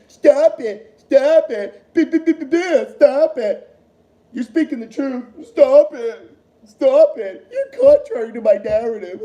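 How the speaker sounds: loud, sputtering mock order to stop; mock moderator. Ranting vlogger mocks the moderator silencing Sachs, loud and sputtering. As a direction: hammer each stop it like a flustered censor.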